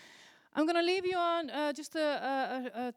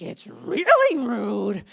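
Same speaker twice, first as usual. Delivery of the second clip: much louder, slow, stretched rude; child siding loudly with grandpa; indignant agreement; 13 dB louder, much slower than usual. Retold or imitated. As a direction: hammer it out like an outraged kid.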